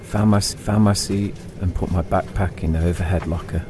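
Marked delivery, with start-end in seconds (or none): none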